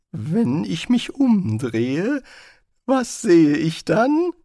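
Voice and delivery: high, slow, loud and tense; frightened wizard. Acted. Rincewind too scared to turn around, high and slow. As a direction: ask it slowly, dreading the answer.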